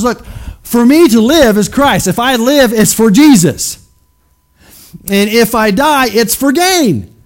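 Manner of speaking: slow ringing declaration of faith; emphatic quoting of Paul. Retold or imitated. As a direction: proclaim Paul's words with fire. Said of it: "Paul says".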